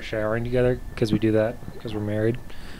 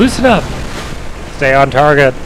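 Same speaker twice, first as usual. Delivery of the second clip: loud urgent coaching shout; 4 semitones higher, 13 dB louder than usual. Real, not acted. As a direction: bark orders at the pilot. Coaching a landing loudly.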